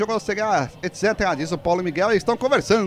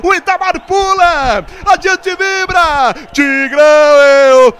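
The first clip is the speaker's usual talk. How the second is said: loud, drawn-out football roar; shouted goal celebration; 4 semitones higher, 11 dB louder, much slower than usual. Real, not acted. Commentator celebrating the Tigre's goal with fans, roaring long and loud. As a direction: stretch every cry, ending on an endless goal shout.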